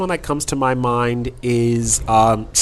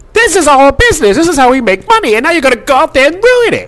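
loud, high, furious promo voice; angry rant; 12 semitones higher, 6 dB louder, faster than usual. Retold or imitated. Impersonating angry Jim Cornette.

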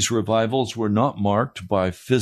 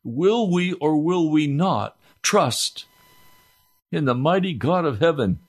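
exhortation; 6 semitones higher than usual. Acted. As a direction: urge the congregation to decide. Preacher climbs again to exhortation.

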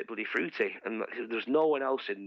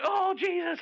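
high loud mock gasp of pain; hammed-up shocked cry; 4 dB louder, slower than usual. Girlfriend feeling the bites, mock cry. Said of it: "she's like".

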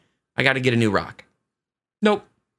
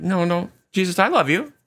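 higher, protesting reassurance; insist, pitch climbing as you go; acted insistence; 7 semitones higher than usual. Peter answering Jesus a second time, higher acted insistence.

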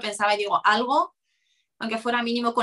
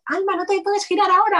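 sharp, high, louder warning; alarmed warning; 9 semitones higher, 4 dB louder than usual. Retold or imitated. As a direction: stop her from turning, urgently. Cristina la avisa alarmada. (aviso alarmado, agudo y fuerte)

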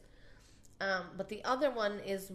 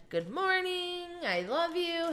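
high sweet voice; 10 semitones higher than usual. Retold or imitated. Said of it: "I said it all in Spanish".

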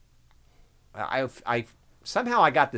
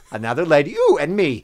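ordinary delivery

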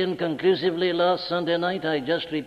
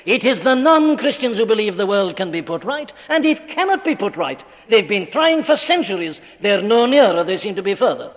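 higher and louder, building fervour; rising preaching; 4 semitones higher, 5 dB louder than usual. Acted. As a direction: climb to the pulpit climax. Preaching crescendo.

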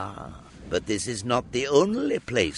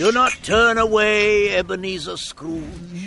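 commanding; 9 semitones higher, 7 dB louder than usual. Acted. Spirit commands Scrooge sternly.

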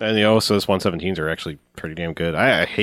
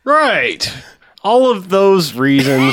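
mock earnest; 10 semitones higher, 6 dB louder, much slower than usual. Echoing the mock ad.

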